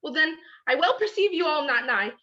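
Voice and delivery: frantic calling. Acted. Hermia searching for vanished Lysander.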